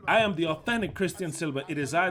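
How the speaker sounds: loud, proud, ringing declaration; loud defiant declaration. Real, not acted. Preacher to his critics, loud defiant boast. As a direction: proclaim it boldly, chest out.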